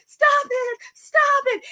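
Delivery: high, mock-frantic plea; mock distress. Acting Missus Hart during the choking.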